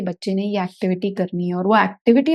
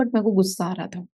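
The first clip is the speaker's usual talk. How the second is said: ordinary talk, nothing marked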